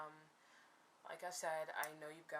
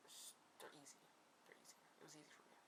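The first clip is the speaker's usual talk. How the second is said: whispered aside; 13 dB quieter, much faster than usual. Real, not acted. Whispered aside about easy parts.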